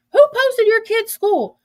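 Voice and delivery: high, loud, drawn-out incredulous question; loud mock-scandalised squeal; mock outrage. Acted outraged reply from texts.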